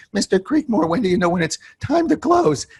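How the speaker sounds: high, keen rookie asking his mentor; eager young rookie. Retold or imitated. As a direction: pitch it up like an eager kid.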